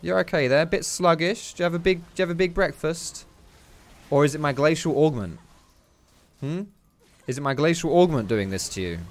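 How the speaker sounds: playful taunting. Pretend. Player teasing a slowed enemy trying to flee, mock-friendly.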